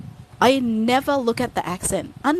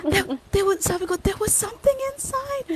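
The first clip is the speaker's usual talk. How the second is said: very high, mock-tearful whine; upset protest; 11 semitones higher than usual. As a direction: plead like a horrified kid, hammed up. Retelling upset child's protest. (kunwaring naiiyak na pangangatwiran)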